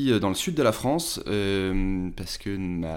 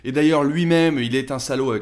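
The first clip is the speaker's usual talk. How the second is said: indignant employee; 6 semitones higher, 5 dB louder than usual. Pretend. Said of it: "le débat va se décaler".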